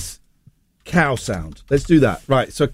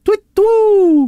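owl hoot; 4 dB louder than usual. Retold or imitated. Imitating an owl call.

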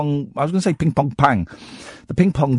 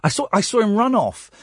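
ordinary talk

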